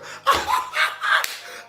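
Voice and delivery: high unvoiced burst of laughter. Real, not acted. Host cracking up at own liquor joke, high laugh.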